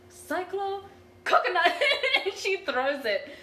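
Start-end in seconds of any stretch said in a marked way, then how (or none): 0.1-1.7 high, loud anime battle cry; yelling attack name. Retold or imitated. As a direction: shout it like a magical girl attack. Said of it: "and she yells".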